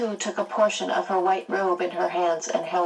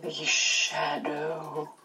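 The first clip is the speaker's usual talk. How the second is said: very slow, eerie, distant and softer; Mrs Which voice; 5 dB quieter, much slower than usual. A narrator's reading. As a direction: stretch every word like an otherworldly witch. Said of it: "Missus Witch spoke".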